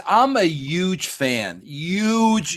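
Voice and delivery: ordinary talk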